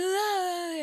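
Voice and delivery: very high, soft "ah"; a high cooing sigh of delight. Retold or imitated. Imitating [name]'s response to a fan, high delighted ah. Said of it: "And she said".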